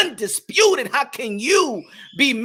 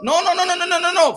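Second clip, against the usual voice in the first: rapid-fire denial; 5 semitones higher, faster than usual. Acted. Cuts off the imagined critic.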